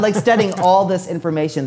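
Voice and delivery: ordinary delivery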